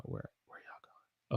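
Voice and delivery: breathy whisper, no voice; whispered line. Shown as a demonstration. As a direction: whisper the question. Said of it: "in a sentence like this".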